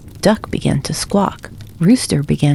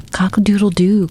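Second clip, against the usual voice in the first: a light rooster crow, a little higher; 4 semitones higher than usual. A narrator's reading. Rooster crowing at Hen about the sun, lightly voiced crow. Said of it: "crowed Rooster".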